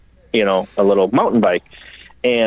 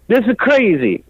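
much higher, exasperated exclamation; Dario impression; 10 semitones higher than usual. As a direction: throw the words over the shoulder. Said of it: "he turns around and he goes".

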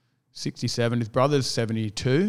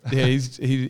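ordinary talk

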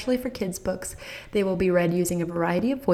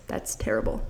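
whispered disgust; 10 semitones lower, 4 dB quieter than usual. Real, not acted.

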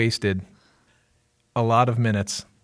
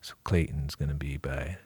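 sleepy mellow Clayton; 6 semitones lower, 6 dB quieter than usual. Pretend. Host teasing the pastor about chamomile, sleepy low mumble. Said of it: "talking like this".

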